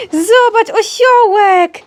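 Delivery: high, loud, drawn-out excitement for the dog; big excited squeal to a pet; excited showing-off. Real, not acted. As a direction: thrill the dog with a new toy. Shows dog a donkey toy.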